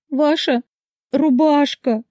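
very high, tearful wail; hysterical sobbing. A narrator's reading. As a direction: squeeze the words out through acted sobs. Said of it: "сквозь истерику с трудом выдавила". (сквозь истерику, с трудом)

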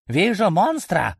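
high, drawn-out sighting call; creature's alert call. A narrator's reading. Familiar reports a monster inside the hero's head, high alert. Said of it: "раздался в голове голос Квазита".

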